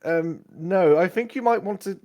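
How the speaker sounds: put-on tentative young servant; young squire. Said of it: "a young squire enters your tent".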